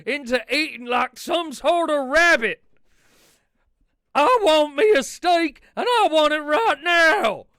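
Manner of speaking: proud red-blooded outrage, bellowed; Dutch ranting. Acted. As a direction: outlaw refusing to eat rabbit food. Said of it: "Well, you can't have one, Dutch".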